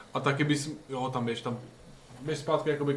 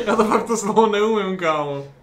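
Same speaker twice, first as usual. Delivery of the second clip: laughing; 7 semitones higher, 8 dB louder than usual. Real, not acted. Laughing at own mispronunciation.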